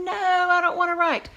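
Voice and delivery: reluctant student. Retold or imitated. Teacher voicing a reluctant pupil, mild whine. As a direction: whine like a kid refusing. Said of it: "some of you guys are going".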